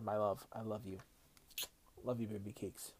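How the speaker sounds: soft, tender love words; hushed and tender to his wife; romantic. Real, not acted. Anniversary message to his wife.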